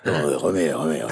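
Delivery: very deep voice-actor imitation; Feodor Atkine. Guest shows the hosts his colleague's voice, deep impersonation. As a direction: sink low, as the veteran dubbing actor. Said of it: "il le fait bien".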